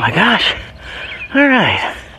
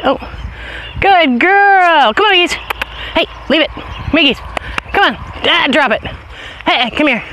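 high dog commands; 10 semitones higher than usual. Real, not acted. Owner calling dog off something dead, high commands. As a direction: call her off the dead thing.

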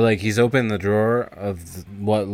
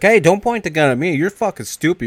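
high, loud retold protest; alarmed scolding; 8 semitones higher, 5 dB louder than usual. Scared friend on the couch at gunpoint, scolding. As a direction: tell him off in raised-pitch alarm.

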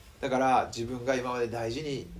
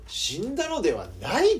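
1 incredulous echo; 7 semitones higher, 3 dB louder than usual. Retold or imitated. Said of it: "この状況で".

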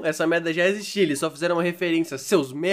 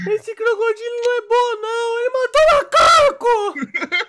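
put-on high, loud, slow enraged child wail; furious crying kid; 6 dB louder than usual. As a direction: shriek it like an outraged kid.

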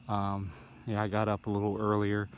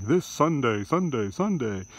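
loud, higher echoing hype announcer; monster truck ad; 4 semitones higher, 5 dB louder than usual. Retold or imitated. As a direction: boom it like a rally promo. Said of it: "a W W E event or a monster truck rally".

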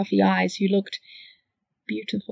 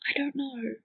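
quiet; 5 semitones higher, 8 dB quieter than usual. Acted. Quiet answer.

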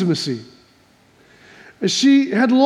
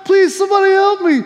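high, loud cry for rescue; acted desperate shout, pitched way up; panicked yelling for help; 10 semitones higher, 4 dB louder than usual. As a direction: yell as if a lion is closing in. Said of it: "started yelling".

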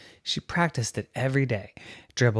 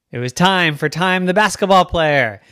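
raised, loud storytelling; excited announcement; 4 semitones higher, 6 dB louder than usual. A narrator's reading. Narrator announces the girl's big moment, excited. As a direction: punch it up with excitement.

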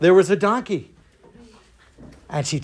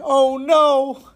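high, wailed dismay; mock crying; 8 semitones higher, 4 dB louder than usual. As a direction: wail it like an embarrassed girl.

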